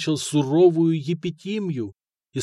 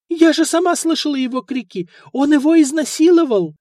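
insistent mother; 12 semitones higher, 6 dB louder than usual. A narrator's reading.

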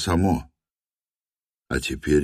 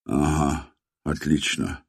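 quiet despairing thought; 2 semitones lower, 4 dB quieter than usual. A narrator's reading. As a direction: think it in despair, softly. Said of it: "с отчаянием подумал Антон". (с отчаянием)

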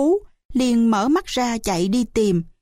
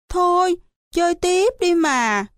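put-on childish pleading; 7 semitones higher than usual. A narrator's reading. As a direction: coax like a little kid. Scared child begs to keep playing. (năn nỉ giọng trẻ con cao)

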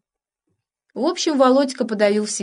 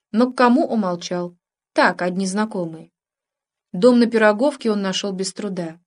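plain reading voice

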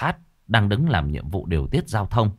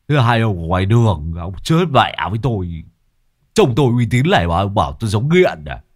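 indignant retort; 4 dB louder than usual. Acted. Hải snaps at the guard.

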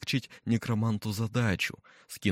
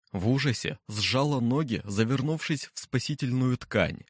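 plain reading voice

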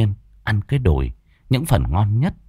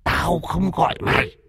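repeated higher, a touch of a haunting stranger's voice; possessed son repeating the rebuff, higher and eerier; eerie strange voice; 8 semitones higher than usual. Acted. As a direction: say it again, sharper and higher. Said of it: "cái giọng nói không phải như thường ngày".

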